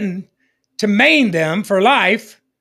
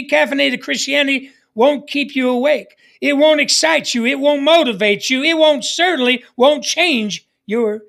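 building preaching; 5 semitones higher than usual. Acted. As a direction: drive each won't home harder. Preaching rising in force.

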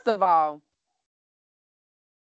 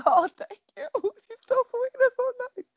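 much higher, tearful voice with a laugh; teary overwhelmed thanks. Retold or imitated. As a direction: gush thanks while welling up. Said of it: "I'm like".

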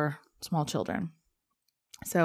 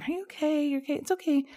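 put-on much higher, gentle mom comforting a crying child; soothing mom; 10 semitones higher, faster than usual. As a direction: coo it like a mother calming her kid. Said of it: "My mom's going".

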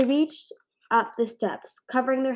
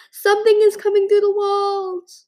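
high, loud terrified shriek; 12 semitones higher, 11 dB louder than usual. A narrator's reading. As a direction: shriek it in panic. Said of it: "she shrieked".

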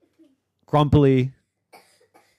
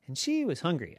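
ordinary delivery, nothing marked

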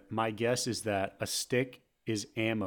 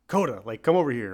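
plain-pitched call, a bit louder; normal yelled command; 5 dB louder than usual. Retold or imitated. Owner calling the dog Coda over, normal yelled command. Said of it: "you normally yell".

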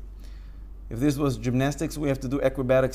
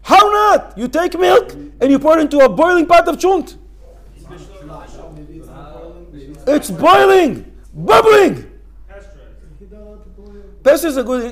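high, loud insistence; heated excitement; 10 semitones higher, 10 dB louder than usual. Real, not acted. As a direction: insist with rising heat. Heated rebuttal to student.

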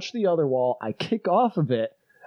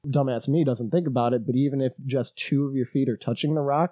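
ordinary delivery

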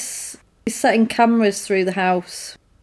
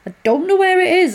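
higher, mock-panicked plea; attacked husband pleading, higher and panicky; panicked repeat; 9 semitones higher, faster than usual.

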